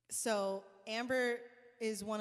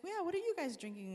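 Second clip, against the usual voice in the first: higher, casual friendly ask; a hint of the star's easy charm; lightly voiced singer; 8 semitones higher than usual. Singer casually asking the band, lightly voiced. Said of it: "She's like".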